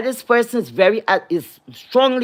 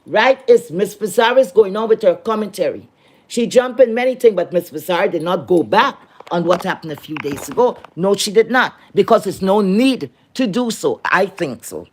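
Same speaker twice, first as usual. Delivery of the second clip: usual speaking voice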